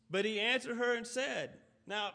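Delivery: usual speaking voice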